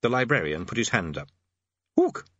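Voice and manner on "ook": short ape hoot; orangutan hoot. Acted. Orangutan librarian offers a suggestion, hooting.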